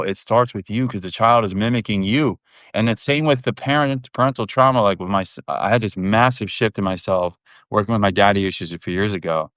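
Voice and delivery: normal talking voice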